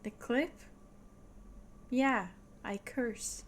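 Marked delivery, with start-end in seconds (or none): none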